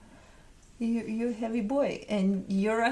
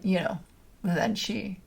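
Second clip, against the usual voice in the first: normal talking voice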